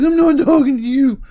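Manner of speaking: high, very loud mock-furious yell; imitated angry screaming. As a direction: yell it like a brother mid-fight. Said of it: "I can still hear him crying, screaming".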